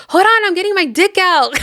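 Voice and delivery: high-pitched, hammed-up interruption; mock eager. Rick on the phone to Carl, mock eager.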